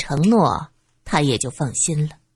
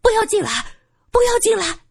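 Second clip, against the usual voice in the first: alarmed cry; faster than usual. A narrator's reading. Tenant stops them entering her home, crying out in alarm. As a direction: cry out in fright to keep them out. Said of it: "金女士惊叫起来".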